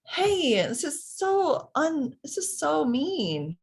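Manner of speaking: put-on high, slow, whiny child protesting; child's upset outburst. Child complaining to parent about a mean friend, high and whiny. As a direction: play the hurt kid crying foul. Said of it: "emotional out-, outburst".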